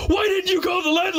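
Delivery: high mock gasping alarm; shocked plumber. Old plumber seeing the flooded bathroom, high shocked voice. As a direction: squeal it like a horrified old plumber.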